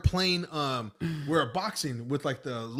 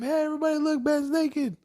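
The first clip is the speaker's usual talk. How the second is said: high mock yell to the whole block; big brother yelling to the neighbors, teasing; 13 semitones higher than usual. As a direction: call it out to shame him. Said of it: "I yelled his name".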